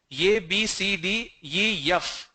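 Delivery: "ye" with a y glide, F as "yef"; y-glided "ye" and F; South Indian alphabet. Shown as a demonstration. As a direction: recite letters with y before vowels. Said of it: "lot of people say ye".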